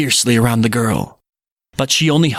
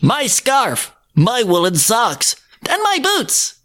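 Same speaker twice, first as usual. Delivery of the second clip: put-on high childlike girl voice; a small girl listing her clothes; bright little girl; 10 semitones higher, slower than usual. Girl character voice.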